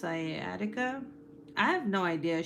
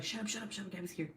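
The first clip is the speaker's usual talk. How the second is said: much softer hurried hush; hushed warning; 10 dB quieter, much faster than usual. Retold or imitated. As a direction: hiss it like the room going silent. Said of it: "everyone's like".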